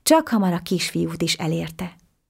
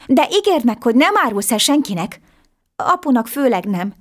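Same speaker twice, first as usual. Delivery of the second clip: put-on high, bright-eyed boy amazed at a talking fish; little boy voice; 6 semitones higher, 4 dB louder than usual. A narrator's reading. Little boy marvelling at the talking fish, high and eager. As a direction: pitch it way up like an excited small boy.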